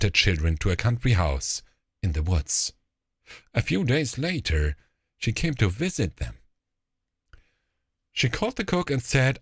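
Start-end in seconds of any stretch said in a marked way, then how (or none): none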